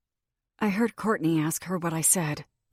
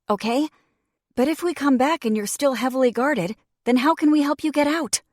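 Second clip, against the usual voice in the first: urgent pep talk; 7 semitones higher, 7 dB louder than usual. Acted. Urgent warning to her friend.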